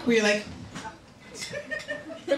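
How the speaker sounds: ordinary talk, nothing marked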